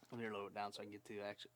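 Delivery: usual speaking voice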